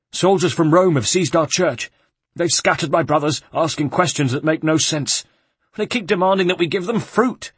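high, breathless monk telling what happened; rattled friar pouring out the news, pitched high; frightened monk. Acted. A shaken monk tells Ezio about the soldiers, high and anxious.

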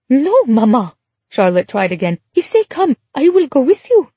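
put-on a child begging to stay with mama; desperate plea. A narrator's reading. Daughter protesting, high.

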